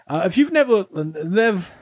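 mock power-down, sinking and fading; batteries running out. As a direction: stammer and wind down like a dying toy. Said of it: "[name] ran out of batteries".

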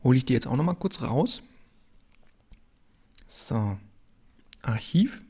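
talking with a mouthful of chocolate; smacking lips between words; chewing while talking. Real, not acted. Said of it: "verkündest du uns jetzt schmatzend".

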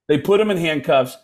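ordinary talk, nothing marked

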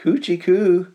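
put-on high, loud giggly baby talk; laughing coo. A narrator's reading. Tug laughing as he twiddles his tendrils. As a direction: coo it playfully, tickling. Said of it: "laughed Tug".

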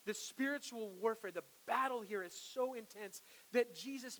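loud, building sermon voice; fervent preaching. Acted. Preacher pressing the point on the congregation, fervent and loud. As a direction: preach it with rising fire.

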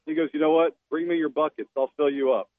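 ordinary delivery

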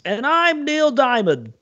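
high, slow show-host voice; announcer voice. Retold or imitated. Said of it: "Celebrity Deathmatch".

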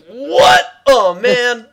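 high, loud incredulous outburst; hammed-up "can't believe it" exclamation; incredulous Rob. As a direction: imitate a friend's booming disbelief. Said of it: "makes him really incredulous, and he's like".

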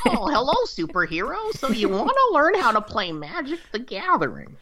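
put-on high crackly old witch. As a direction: play the wise old crone greeting a hero. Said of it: "I'm gonna continue using the voice".

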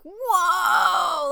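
wide-eyed delighted shout; amazed whoa. Retold or imitated. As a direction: gasp it out like you just saw real magic. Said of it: "the face that Beard made".

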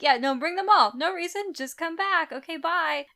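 high, falsely innocent brush-off; hammed-up cheery send-off; mock-casual urging. Voices witch hurriedly urging the boy.

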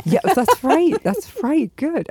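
high, loud cheering; excited cheer. Real, not acted. Cheering on the host who joins her class. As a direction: cheer them on with delight. Excited welcome of new student.

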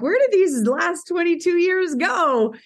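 wistful disbelief. Retold or imitated. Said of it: "the next minute you're like".

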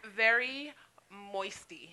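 very slow, savouring the word. Real, not acted. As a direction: stretch out very moisty. Coining a word, drawn out.

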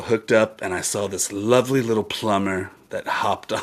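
normal talking voice

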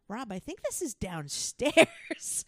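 imitated eager announcement; excited alert. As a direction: draw it out with rising urgency. Retelling excited alert to friend.

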